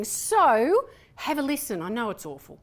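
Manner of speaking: ordinary talk, nothing marked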